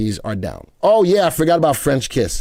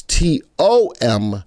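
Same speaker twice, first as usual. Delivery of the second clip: slow, each letter said apart; spelled out letter by letter; 4 semitones lower, slower than usual. Shown as a demonstration. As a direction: spell the name slowly, T O M P K I N S. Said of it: "T O M P K I N S".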